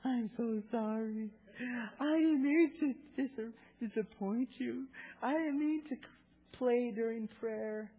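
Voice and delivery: put-on very high, slow, tearful child's voice; crying little girl. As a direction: sob it out like a small girl. Imitating crying little daughter.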